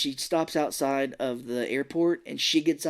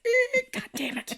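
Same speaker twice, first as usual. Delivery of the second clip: high squeaky creak, then drawn-out curse; mock exasperation. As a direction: play a foiled sneak, hammed-up. Imitated creak and frustrated curse.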